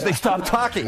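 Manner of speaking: usual speaking voice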